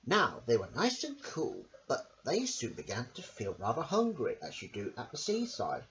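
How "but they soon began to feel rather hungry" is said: ordinary delivery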